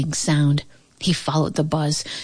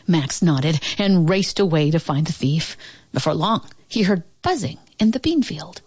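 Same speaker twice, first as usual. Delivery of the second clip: ordinary delivery, nothing marked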